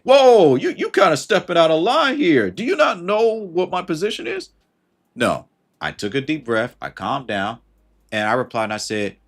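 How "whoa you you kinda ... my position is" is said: high, loud indignant outburst; affronted and louder, pitched up; indignant old me. Retold or imitated. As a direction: bristle like someone overstepped you. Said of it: "old me would have been like".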